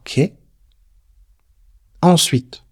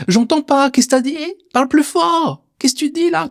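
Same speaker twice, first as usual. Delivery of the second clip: much higher, teasing pretend deafness; mock not hearing; 13 semitones higher than usual. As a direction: play dumb to wind up the inner voice. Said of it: "Je peux jouer avec cette voix".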